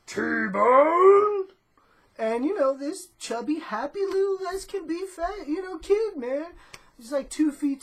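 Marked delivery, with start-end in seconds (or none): 0.1-1.5 loud call from the porch; imitated yell. Neighbor lady calling her kid from the porch, loud imitated yell. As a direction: holler the kid's name down the block. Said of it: "you hear her yell".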